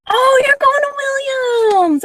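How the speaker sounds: high, loud, slow joyful exclaim; light up at happy news; excited delight. Real, not acted. Tarot reader greets viewer's Williams news with excited delight.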